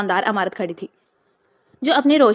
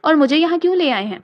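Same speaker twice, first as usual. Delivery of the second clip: frightened; 5 semitones higher than usual. A narrator's reading. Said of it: "بطول نے خوفزدہ ہو کر بولی".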